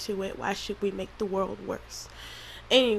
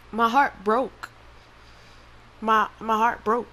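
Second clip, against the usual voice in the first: real heartbroken halting speech, louder; tearful; 4 dB louder than usual. Real, not acted. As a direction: push the words out through tears. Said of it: "I wasn't gonna cry through this".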